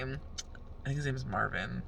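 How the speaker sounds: usual speaking voice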